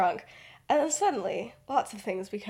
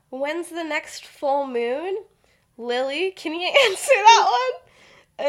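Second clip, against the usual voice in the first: sly teasing; 8 semitones higher, 8 dB louder, slower than usual. Retold or imitated. Peter's sly hint.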